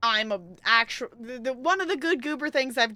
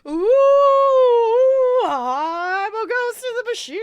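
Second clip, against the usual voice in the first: high, loud wailing ghost voice; teasing a friend on the phone with a loud ghost wail; spooky ghost wail; 12 semitones higher, 11 dB louder, much slower than usual. Pretend. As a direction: wail it out like a haunting spirit. Pretends to be a ghost.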